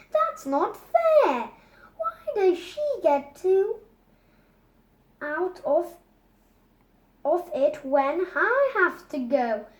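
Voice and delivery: put-on a kid crying unfairness; indignant little boy pleading; Bertie protesting. A narrator's reading.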